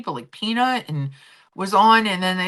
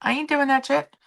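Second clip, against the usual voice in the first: sassy refusal; 10 semitones higher than usual. Retold or imitated. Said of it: "be like".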